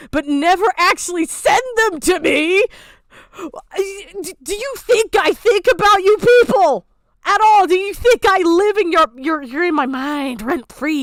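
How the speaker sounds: high, loud exasperated ranting; angry rant. Real, not acted. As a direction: vent your fury at them. Ranting about statements.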